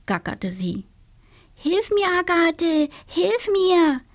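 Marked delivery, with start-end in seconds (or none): 1.5-4.0 high wailing witch plea; whining little witch. Acted. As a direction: wail for help, much higher. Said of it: "jammerte die kleine Hexe".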